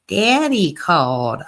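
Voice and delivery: slow, drawn-out "Daddy" in imitation; mocking mom's "daddy". Host mimicking a phrase she hates, mocking imitation. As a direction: stretch out "Daddy" to mock the mom. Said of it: "I hate how she says daddy".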